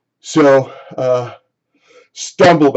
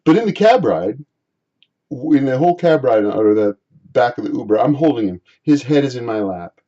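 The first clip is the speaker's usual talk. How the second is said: usual speaking voice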